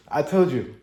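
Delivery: normal talking voice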